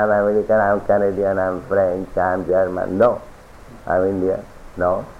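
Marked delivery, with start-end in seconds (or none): none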